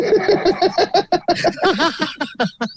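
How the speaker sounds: real loud cackling laugh; loud high laugh. Real, not acted. Laughs at Apollo gimmick.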